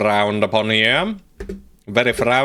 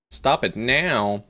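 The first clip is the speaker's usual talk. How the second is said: ordinary talk, nothing marked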